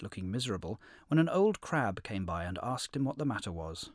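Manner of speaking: plain reading voice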